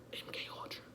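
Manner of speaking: hushed unvoiced whisper. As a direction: breathe it like a secret. Conspiracy aside, whispered.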